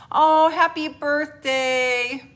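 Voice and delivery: high, loud and drawn out; slow gushing birthday wish. Real, not acted. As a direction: stretch out a delighted happy birthday.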